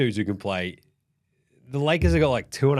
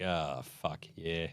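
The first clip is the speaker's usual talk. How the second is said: low, very soft defeated grumble; LeBron's weary resignation; resigned mutter; 10 semitones lower, 14 dB quieter, much slower than usual. Retold or imitated. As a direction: concede it under your breath. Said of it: "And LeBron's like".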